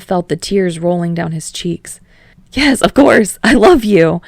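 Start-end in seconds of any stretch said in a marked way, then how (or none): 2.5-4.2 higher, much louder overjoyed yes; near scream. Acted. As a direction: burst out with tearful joy. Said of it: "Practically screamed".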